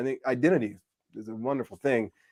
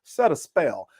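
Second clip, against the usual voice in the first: Southern accent. Shown as a demonstration. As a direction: stretch it out like a hospitable Southerner. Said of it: "my voice starts to change".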